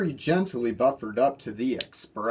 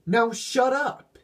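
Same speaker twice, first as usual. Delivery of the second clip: stern order; 11 semitones higher, slower than usual. A narrator's reading. Diesel orders his grumbling partner, slow and stern. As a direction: shut him up firmly.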